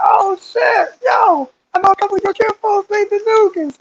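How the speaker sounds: high, excited outburst; excited recognition. Retold or imitated. Recruit excitedly telling a new friend he knows his kinfolk, high and excited. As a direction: burst out like you found someone's family.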